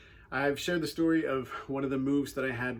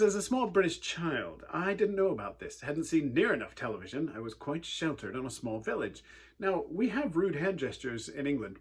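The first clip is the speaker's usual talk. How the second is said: ordinary talk, nothing marked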